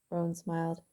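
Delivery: plain reading voice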